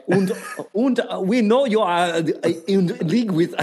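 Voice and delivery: halting mock-German interrogator; badly faked German accent, searching for words; attempted German accent. Shown as a demonstration. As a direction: have a faltering second go at a German accent. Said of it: "do a better German accent than that".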